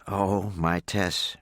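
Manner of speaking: slow, lightly voiced complaining sigh; a gentle scold, half in tears; mother gently complaining that Tess will set her crying again; mild complaint. A narrator's reading. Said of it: "her mother complained".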